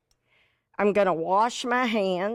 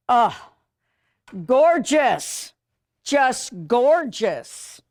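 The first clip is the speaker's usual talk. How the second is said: delighted; 4 semitones higher, 5 dB louder, much slower than usual. Real, not acted.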